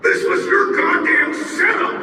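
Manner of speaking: high, loud accusing shout; angry call. Acted. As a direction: accuse them, furious. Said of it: "I called".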